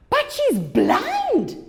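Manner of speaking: high, very slow incredulous protest; father's objection. Acted. Father objecting that Eva is blind, high incredulous. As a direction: stretch it out in disbelief, high and slow. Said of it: "His father says".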